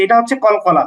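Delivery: ordinary talk, nothing marked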